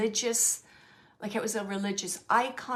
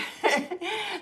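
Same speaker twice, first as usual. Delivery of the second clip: bright laugh; 10 semitones higher than usual. Real, not acted. Laughing at the spirits' words.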